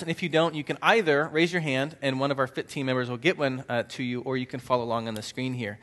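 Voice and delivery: ordinary talk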